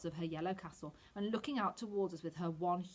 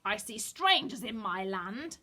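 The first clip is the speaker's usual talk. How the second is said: high, slow, strange witch screech; a cackling witch spotting intruders; witch shriek; 3 semitones higher, 6 dB louder, slower than usual. A narrator's reading. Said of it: "she was shrieking".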